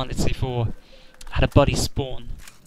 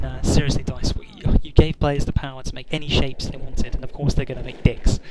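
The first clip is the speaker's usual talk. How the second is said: ordinary delivery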